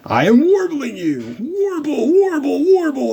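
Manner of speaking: very high, far warbly chant, slow start; witch warbling. Retold or imitated. Mocking the witches' captioned warbling chant, very high. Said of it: "it just says warbling".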